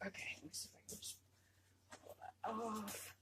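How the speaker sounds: fast whispered aside; rushed muttered whisper; quick whisper. Real, not acted. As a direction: whisper it rapidly to herself.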